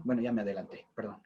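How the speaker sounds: real murmured apology to listeners; quick apologetic aside. Real, not acted. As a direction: drop your voice for a self-correction. Apologetic aside on jumping ahead.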